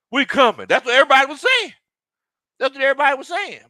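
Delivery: loud, high, rapid-fire excitement; worked up and insistent, voice soaring; hyped-up fans. Retold or imitated. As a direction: echo the crowd's hype at full tilt. Re-enacting the fans' hype.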